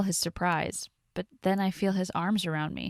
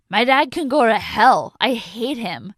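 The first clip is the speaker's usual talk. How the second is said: much louder, higher furious yell; angry outburst; 4 semitones higher, 9 dB louder than usual. Acted. Drunk Tony slamming the bar about his cop dad, yelling. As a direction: lash out at his father.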